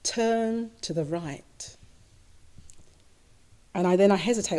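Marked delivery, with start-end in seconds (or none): 0.0-1.8 slow, soft and gentle; a soft, slow, encouraging inner voice; calm encouraging voice. Retold or imitated. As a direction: coax them gently to the right. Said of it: "It's still calm, it's still warm".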